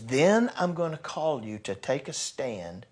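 ordinary talk, nothing marked

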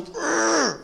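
high, strained mock growl; tough-guy growl. Preacher who used to never cry, tough-guy growl. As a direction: growl to show how hardened you were. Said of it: "I used to be so".

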